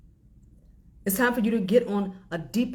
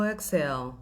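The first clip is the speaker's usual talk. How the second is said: guided breathing; slower than usual. Acted. Leads a breathing exercise.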